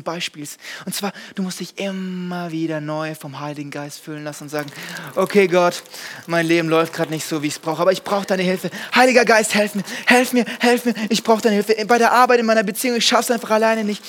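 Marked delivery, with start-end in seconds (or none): 5.2-14.0 louder, urgent calling on God; fervent prayer; pleading prayer. Acted. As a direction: beg for help out loud. Pleading prayer to Holy Spirit.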